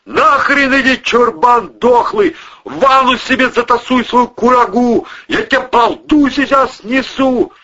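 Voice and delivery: high, loud threats; angry shouting. Acted. Man threatening the monster behind the door, angry shouting. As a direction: yell curses at full volume.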